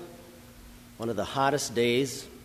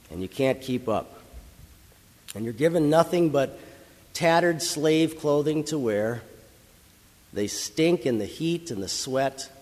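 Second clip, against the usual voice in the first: ordinary talk, nothing marked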